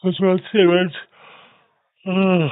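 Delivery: loud, high-pitched exclamation; excited shout. Real, not acted. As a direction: belt it out with excitement. Excited call on a near pin.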